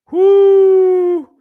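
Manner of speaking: a sudden, joyful high-pitched whoop; loud high whoop. Real, not acted. Vlogger whooping at his audience, loud and high. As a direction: let out a big excited whoo.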